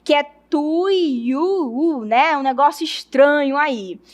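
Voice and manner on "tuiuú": "tuiuú" stretched very slowly; tricky word "tuiuú" with long held vowels; careful difficult word. Shown as a demonstration. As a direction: draw out "tuiuú" cautiously, syllable by syllable. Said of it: "eu tenho dificuldade de falar".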